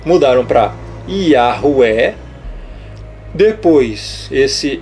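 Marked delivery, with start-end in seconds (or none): 1.1-2.1 "Yahuwah" slow, ending on an open E; altered pronunciation. Shown as a demonstration. Teacher listing invented names for viewers, "Yahuwah" slowly ending in EH. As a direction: drag out "Yahuwah" as ya-hu-EH. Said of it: "o que que eles inventaram mais".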